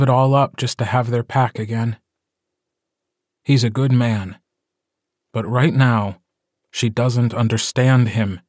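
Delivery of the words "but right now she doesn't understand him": usual speaking voice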